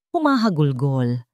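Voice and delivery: usual speaking voice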